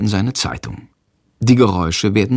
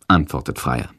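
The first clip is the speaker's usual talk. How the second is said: usual speaking voice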